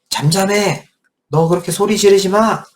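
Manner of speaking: high, sharp scolding; rebuking. Acted. Followers rebuking the beggar, high and scolding. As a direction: tell him off to hush. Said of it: "꾸짖었다는 거예요".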